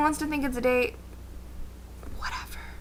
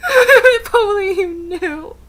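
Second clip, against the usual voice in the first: slow, louder, crying repeat; mock sob; 8 semitones higher, 8 dB louder, much slower than usual. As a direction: sob the line out slowly.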